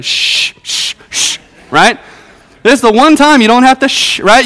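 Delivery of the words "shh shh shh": long hissed shushes; repeated drawn-out "shh"; parental shushing. Acted. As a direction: hush them like a parent in church. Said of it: "your parents tell you".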